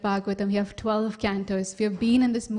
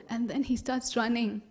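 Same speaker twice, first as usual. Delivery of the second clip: ordinary delivery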